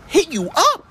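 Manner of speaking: high-pitched, incredulous scolding; hammed-up outrage, voice shooting up high; mock outrage. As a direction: scold them in exaggerated disbelief. Scolding an oversharing poster's quote.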